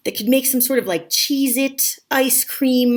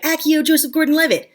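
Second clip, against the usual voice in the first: lightly acted high incantation; a touch of wizardly command; mock spellcasting; 6 semitones higher than usual. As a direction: pretend summoning spell, pitched up. Demonstrating a summoning spell.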